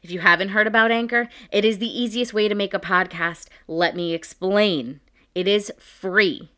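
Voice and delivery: loud, punchy sponsor read; podcast ad read. Acted. Host pitches a podcast app to listeners, emphatic ad read. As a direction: sell it with firm, emphatic projection.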